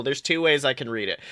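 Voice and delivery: ordinary talk, nothing marked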